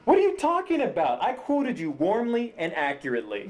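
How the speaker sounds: high-pitched indignant protest. Acted. Writer defending his script to his ex, indignant. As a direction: defend yourself, pitch jumping up.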